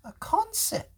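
high bright praise; excited. Real, not acted. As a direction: lift the voice way up to praise. Father echoes child's answer.

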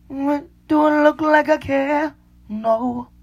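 high, loud, scornful yell; mocking shout. At Instagram drinkers, a loud scornful yell.

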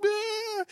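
high, held mock bawl; imitated crying wail. Daughter overcome showing dad her old game, imitated high wail. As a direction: squeal out a pretend happy-sad cry. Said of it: "laughing and crying at the same time".

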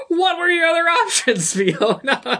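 high-pitched question breaking into laughter; laughing incredulity. Real, not acted. Podcaster mocking the nurse's obvious guess, laughing incredulity. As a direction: laugh helplessly through the question. Laughing at a movie line's absurdity.

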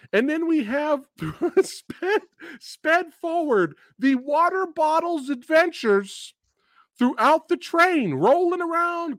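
high-pitched, laugh-broken incredulity; worked-up, exasperated comic recap; incredulous rant. Real, not acted. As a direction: tell it high, barely holding back laughter. Incredulous retelling of absurd ending.

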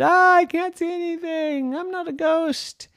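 very high-pitched helpless whine; protesting brother. A narrator's reading. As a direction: wail as the blinded prankster. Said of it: "And he said".

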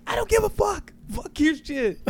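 very high, strained imitation of a partner; mock angry outburst. Partner sick of wrestling news, high mock-angry outburst. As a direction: shriek it in mock fury, without real volume.

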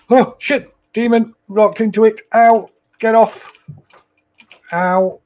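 high panicked yelps; startled yelling. Real, not acted. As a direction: yelp it out, caught off guard. Attacked by demon in game.

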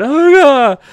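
high, loud panicked yelling; mock panic. Jason running through a haunted house, mock panic. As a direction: freak out at full volume, hammed up. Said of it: "he's in a panic mode".

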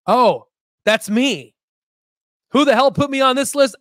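real shock at seeing his own name; stunned, then exclaiming; surprised disbelief. Real, not acted. Finds himself on top list.

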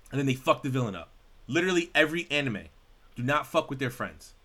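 ordinary talk

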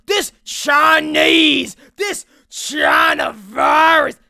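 slow, loud, high shout; mock politician shout. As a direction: drag out each word in a put-on voice. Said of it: "they're like".

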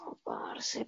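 breathy whispered "Oh, parce"; exasperated sigh. Retold or imitated. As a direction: sigh it out, fed up. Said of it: "y para otras cosas yo digo".

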